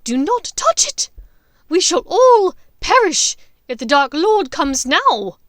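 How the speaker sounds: terrified witch shouting; panicked Bellatrix. Acted. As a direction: warn them in panic, high and loud. Voices Bellatrix.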